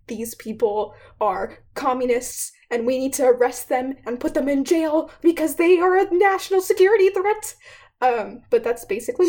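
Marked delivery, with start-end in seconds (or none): 0.1-7.6 building mock tirade, ending high and loud; McCarthy. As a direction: accuse with rising, hammed-up alarm. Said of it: "And he's like".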